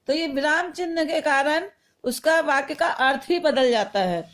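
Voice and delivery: ordinary delivery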